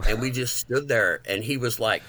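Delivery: usual speaking voice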